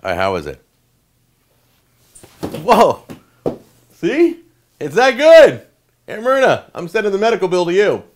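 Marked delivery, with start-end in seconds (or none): 2.4-8.0 much higher and louder, bursting; overjoyed shout. Real, not acted. Reacting to the cheesecake for the camera, ecstatic shout. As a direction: shout with over-the-top delight. Son's reaction, huge excitement.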